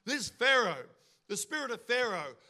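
ordinary delivery

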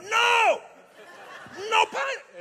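put-on high, loud Italian-accented outburst; mock Italian accent. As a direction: protest hotly, hamming up an Italian accent. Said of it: "I'll forget the accent".

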